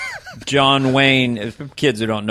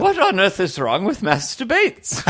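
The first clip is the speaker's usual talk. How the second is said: higher, slow mock-posh lady; 7 semitones higher than usual. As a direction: play the aristocrat, slowly and laughing. Downton lady voiced.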